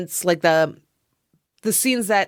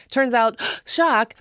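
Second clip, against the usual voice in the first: higher, with a staged gasp; mock shock; 6 semitones higher, slower than usual. Revealing the chant's real meaning to listeners, mock shocked. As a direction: feign surprise at the obvious twist. Sarcastic feigned surprise at twist.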